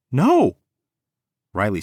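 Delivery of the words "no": high, shrill shout of refusal. A narrator's reading. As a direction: scream in protest. She screams at him.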